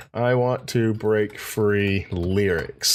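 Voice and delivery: very slow, drawn-out words; slow dictation. Shown as a demonstration. Host dictating a lyrics search, slow and deliberate. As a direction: spell out the search word by word, slowly.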